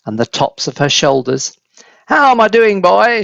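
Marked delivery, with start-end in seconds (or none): 2.1-3.2 loud, high triumphant call from the roof; grandma yelling from the rooftop; shouting. A narrator's reading. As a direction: shout it down gleefully. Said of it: "she shouted".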